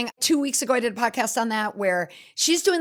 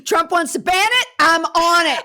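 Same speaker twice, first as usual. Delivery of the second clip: mock rallying cry; 6 semitones higher, 5 dB louder than usual.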